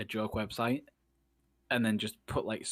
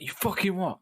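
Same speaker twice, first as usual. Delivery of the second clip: high-pitched mock-aggressive challenge; pitched-up, indignant comeback, played for laughs; 9 semitones higher, 3 dB louder than usual. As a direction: act out turning aggressive in an instant. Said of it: "aggressive as fuck or polite as fuck".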